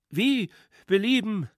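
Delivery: high, loud piping youth; heckler's voice. A narrator's reading. Drunk schoolboy mockingly welcomes His Excellency, high heckling. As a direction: greet him in a high put-on voice. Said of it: "schrie die Stimme abermals".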